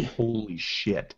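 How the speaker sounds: soft, slow, drawn-out words; mouthed silently. Acted. Character mouths shock to companion, hushed. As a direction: barely voice it, stretched out. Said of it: "he mouths the words".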